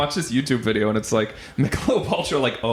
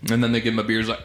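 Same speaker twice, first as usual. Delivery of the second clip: ordinary delivery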